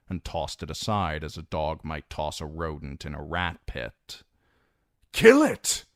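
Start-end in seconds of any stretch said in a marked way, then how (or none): 5.1-5.9 high, loud command; shouted order. Acted. Commander to his spearmen: high, loud shouted order. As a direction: bark the kill order. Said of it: "shouted to the other spearmen".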